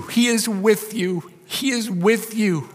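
high, slow, pressing on he; slow rising insistence; emphatic reassurance. Acted. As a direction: lean into the promise slowly. Slowed emphatic repeat.

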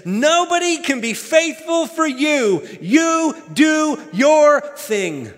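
put-on high, loud, drawn-out Southern accent; Beth Moore. Preacher quoting an author to the church, loud Southern accent. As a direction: belt it out in a Southern accent, stretching the last words. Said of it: "Beth Moore in her southern accent says this".

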